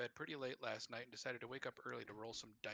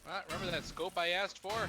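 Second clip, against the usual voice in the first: higher and louder teasing lead-up; excited; 7 semitones higher, 10 dB louder than usual. Real, not acted. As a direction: build up the good news. Found the wanted scope.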